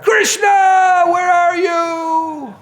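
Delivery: long, high drawn-out shout; calling out. Retold or imitated. Thief trying the new name, loud high calls. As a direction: call into the forest. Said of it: "I should be calling out".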